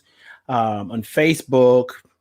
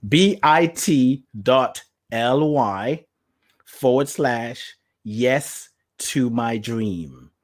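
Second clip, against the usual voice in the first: slow spelled link; much slower than usual. Shown as a demonstration. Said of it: "here's a supposedly short link".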